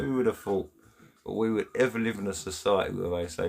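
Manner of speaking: ordinary delivery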